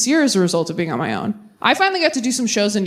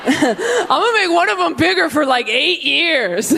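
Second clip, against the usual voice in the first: laughing; 6 semitones higher than usual. Pretend. God scheming, laughing.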